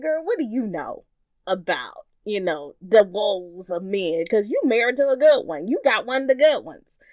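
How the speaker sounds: loud, high teasing aunties' voice; teasing aunties. Retold or imitated. Aunties teasing a niece about her good husband. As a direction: rib her loudly like the aunties do. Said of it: "would constantly like".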